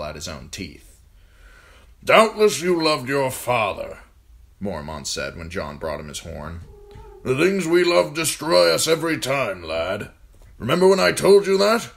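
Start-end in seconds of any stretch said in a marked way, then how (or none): 2.0-11.9 put-on raised, loud commanding old man; gruff old lord commander. A narrator's reading. Old commander ordering John to serve food, loud and gruff. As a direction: bark orders at the boy like an old soldier. Mormont voiced in story.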